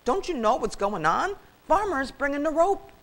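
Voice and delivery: high, exasperated scolding; granddad's high-pitched reproach; chiding. A narrator's reading. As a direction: scold him with urgent disbelief. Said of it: "chided old Granddad".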